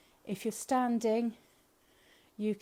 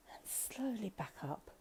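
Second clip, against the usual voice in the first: whisper; 9 dB quieter than usual. Shown as a demonstration.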